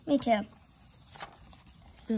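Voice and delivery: plain reading voice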